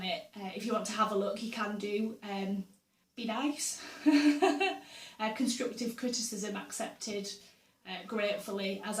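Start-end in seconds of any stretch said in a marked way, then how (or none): 3.9-5.2 bright burst of giggling; short real laugh. Real, not acted. Laughing after asking viewers to be nice, a short giggle. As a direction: giggle at her own joke.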